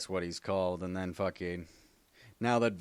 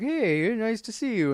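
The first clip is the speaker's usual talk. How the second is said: high, sweet mock girlfriend voice; high falsetto girly greeting; 13 semitones higher, 5 dB louder than usual. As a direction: pitch way up to play the lady friend. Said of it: "his girlfriend's like".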